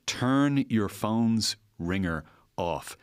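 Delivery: usual speaking voice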